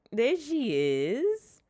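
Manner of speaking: high, slow, drawn-out coo; cooing greeting. Real, not acted. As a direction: greet your pet with a loud stretched coo. Cooing as the cat appears.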